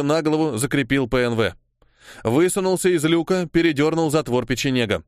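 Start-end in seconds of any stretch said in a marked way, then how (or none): none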